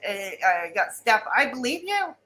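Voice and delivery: ordinary delivery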